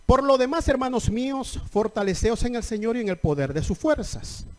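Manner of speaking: lectura fuerte y proclamada de la Biblia; lectura bíblica predicada. Acted. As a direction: proclama el versículo ante la congregación. Lee las escrituras con fuerza. (lectura de predicador, enérgica y proclamada)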